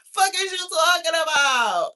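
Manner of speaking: dragging out a loud, high-pitched outraged shout; incredulous shout. Acted. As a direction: yell it in disbelief, drawn out. Repeats it louder.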